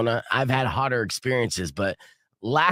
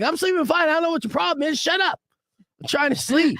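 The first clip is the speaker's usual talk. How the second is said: high-pitched, exasperated mock outburst; hammed-up fed-up complaint; irritated retort; 14 semitones higher, faster than usual. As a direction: act out a cranky sleeper telling off a partner. Acted irritated reply to girlfriend.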